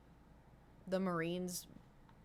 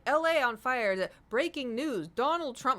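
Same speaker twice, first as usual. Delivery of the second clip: mock news alarm; 5 semitones higher, 6 dB louder than usual. Mock breaking news feed.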